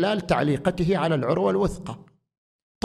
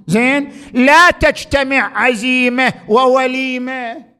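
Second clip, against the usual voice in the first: preaching at full force; 8 semitones higher, 8 dB louder than usual. Acted. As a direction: hammer home the saying like a preacher. Preacher raises voice on the maxim. (خطابة بصوت عال ومرتفع)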